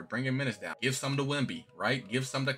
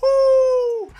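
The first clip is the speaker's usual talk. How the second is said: ordinary delivery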